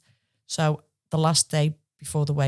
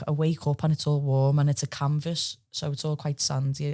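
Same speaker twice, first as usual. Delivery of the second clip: ordinary delivery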